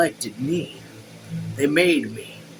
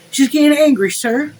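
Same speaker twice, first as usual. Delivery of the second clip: much higher, louder servant voice; alarmed servant; 11 semitones higher, 6 dB louder than usual. A narrator's reading. Joseph warning the mayor, high urgent voice. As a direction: raise it high to report trouble.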